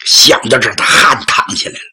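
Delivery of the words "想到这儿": usual speaking voice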